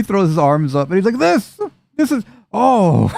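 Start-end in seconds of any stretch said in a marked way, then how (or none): 1.2-3.1 high-pitched stammering exasperation; acted speechless outrage; exasperated sputter. Character throws up arms in exasperation.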